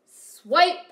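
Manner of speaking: a sharp, loud whooshing "Swipe"; swipe sound effect. Retold or imitated. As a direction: snap the word out like a blade slicing. Food processor blade slicing hand.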